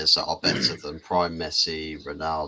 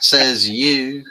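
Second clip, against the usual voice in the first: high, loud jab back; mock indignant retort; 8 semitones higher, 10 dB louder than usual. Real, not acted. Teasing a friend about his accent, loud high retort.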